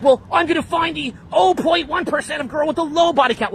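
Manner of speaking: high-pitched mock objector; mocking imitation. Guest imitates a listener seeking a rare girl, high mocking voice. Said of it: "Now you're like".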